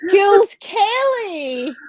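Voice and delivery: high, drawn-out cheer of a name; sing-out welcome, stretched wide; big guest introduction. Real, not acted. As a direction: announce the guest with a long, high flourish. Announcing her guest with flourish.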